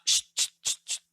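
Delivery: quick, separate "shh, shh, shh" bursts; staccato shushing; short repeated shushes. Shown as a demonstration. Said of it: "tiene una acentuación diferente".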